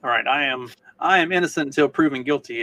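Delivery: ordinary talk, nothing marked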